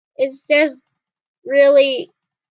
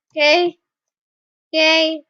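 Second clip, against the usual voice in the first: usual speaking voice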